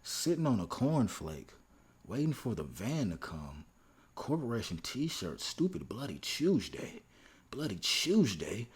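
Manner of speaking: slow, deliberate poetry reading; dramatic lyric recitation. Acted. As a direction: recite each phrase with weighty pauses.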